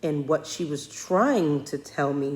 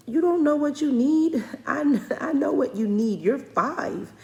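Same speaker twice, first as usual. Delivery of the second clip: much higher and louder, playful exasperation; mock outraged mom; 11 semitones higher, 6 dB louder than usual. Mother replaying her upset at her five-year-old, mock outraged. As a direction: scold the little one, laughing at yourself.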